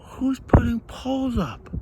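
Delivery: normal talking voice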